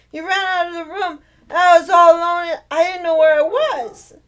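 put-on high, frightened child's voice, close to tears; a small girl who woke up alone; scared little girl. Little girl telling her mom she was scared, frightened child's voice.

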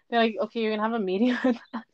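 quick words through a real laugh; amused, laughter breaking in; laughing while talking. Real, not acted. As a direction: giggle through the retold line. Laughing while retelling the reprimand.

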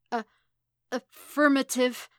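slow, choked, frightened reply. A narrator's reading. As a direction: force the word out after a false start. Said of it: "he choked out".

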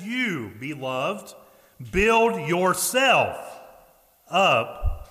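"build yourself up" drawn out very slowly and hit hard, "up" loudest; slow emphatic stress. Shown as a demonstration. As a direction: preach "build yourself up" word by word, slowly and loudly. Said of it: "Yourselves implies a personal responsibility".

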